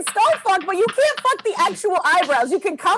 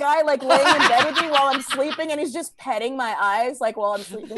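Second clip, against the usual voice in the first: ordinary talk, nothing marked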